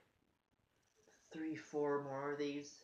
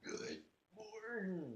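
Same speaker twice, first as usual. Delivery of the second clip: belch the greeting slowly; burping the words; 6 semitones higher, 4 dB quieter, much slower than usual. Shown as a demonstration. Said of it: "I was trying to say good morning".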